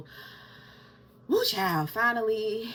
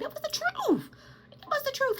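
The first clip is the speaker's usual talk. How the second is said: much higher, squeaky insistence; mock high defensive voice. To her viewers about telling her son, in a squeaky mock voice.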